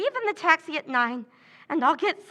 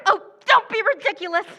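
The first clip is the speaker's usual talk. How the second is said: scornful dismissal; 4 semitones higher, 6 dB louder, faster than usual. Acted. Pam dismisses Kathy's hope.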